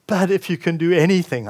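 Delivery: ordinary delivery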